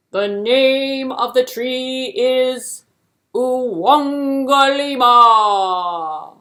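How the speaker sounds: slow, loud, regal king's voice; booming king. Acted. King grandly telling hare the tree's name, loud and slow.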